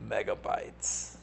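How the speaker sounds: a deflated, whispered megabytes; stunned whisper. Real, not acted. Whispering to himself in shock at the slow plan. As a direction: breathe the word out in disbelief, slow and falling.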